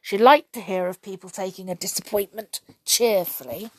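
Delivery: ordinary delivery, nothing marked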